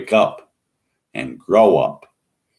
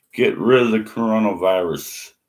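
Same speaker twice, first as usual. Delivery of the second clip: ordinary delivery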